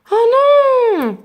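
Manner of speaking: lamenting crowd. Acted. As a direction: cry out like a let-down crowd. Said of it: "se lamente à nouveau la foule".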